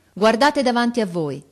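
shouted order. A narrator's reading. Officer to his soldiers, shouted order. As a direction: call out sharply to the troops. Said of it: "gridò l'ufficiale ai soldati".

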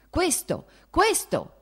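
high, loud furious bark; angry shout. Acted. The prince answering his wife, angry shout. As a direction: spit it at her in rage. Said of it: "gridò con rabbia il principe".

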